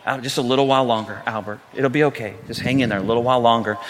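low, quiet self-soothing murmur; calm dad. Retold or imitated. Dad pushing the cart past stressed shoppers, calmly. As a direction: talk yourself down gently, voice low and even. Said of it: "the dad's just calmly".

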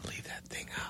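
hushed unvoiced aside; whisper. Real, not acted. Guest whispering to hosts to cut it, whispered. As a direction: whisper it off-mic so nobody hears.

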